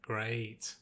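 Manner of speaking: low, soft grumble; grumbled. A narrator's reading. Rainbow grumbling about the wolf joining, sarcastic. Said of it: "Rainbow grumbled".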